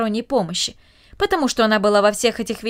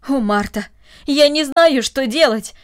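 louder, higher burst of helplessness; flustered exclamation; 4 semitones higher, 6 dB louder than usual. A narrator's reading. Helmut bursts in on Martha, flustered and exclaiming. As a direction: blurt it out, at a loss. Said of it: "воскликнул он в смущении".

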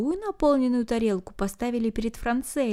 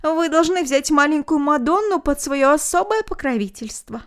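hearty loud request; 6 semitones higher, 5 dB louder than usual. A narrator's reading.

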